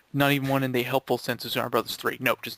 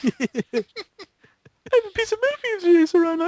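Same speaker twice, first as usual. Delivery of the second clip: squeaky character voice; 5 dB louder than usual. Acted. Friend voices a game character offering metal, very high. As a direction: squeak it out like a tiny cartoon hero.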